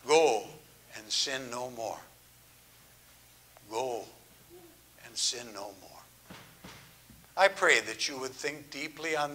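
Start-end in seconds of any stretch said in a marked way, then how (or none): 0.1-2.0 hushed, low-pitched compassion; ever so gentle. Acted. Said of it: "said to her ever so gently". 3.7-6.0 very soft, low and tender; gentle words, hushed and deep; ever so gentle. Acted. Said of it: "said to her ever so gently".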